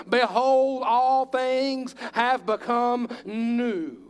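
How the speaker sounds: preacher proclaims the verse, slow and loud; emphatic proclamation. Acted. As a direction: stretch each word out as a proclamation. Preacher quoting scripture emphatically.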